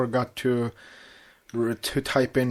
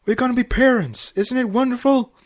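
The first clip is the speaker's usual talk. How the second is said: much higher, bubbling with joy; overjoyed Flash; 12 semitones higher, 7 dB louder than usual. Acted. Flash celebrates becoming a parent with Twilight, overjoyed. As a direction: spin her round with happiness. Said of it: "Flash smiled".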